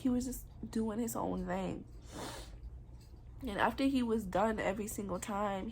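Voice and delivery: ordinary talk, nothing marked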